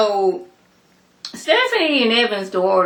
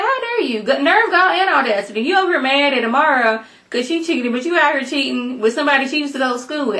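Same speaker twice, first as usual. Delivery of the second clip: mock outrage; 6 semitones higher, faster than usual. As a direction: squeal it out like a scandalized viewer. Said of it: "I was about to get mad".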